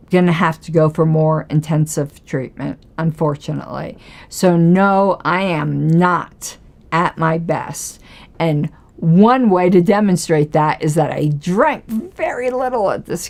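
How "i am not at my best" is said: normal talking voice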